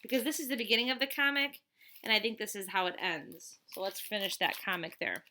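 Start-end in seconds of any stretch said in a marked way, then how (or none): none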